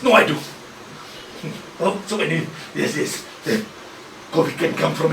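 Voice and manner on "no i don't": normal talking voice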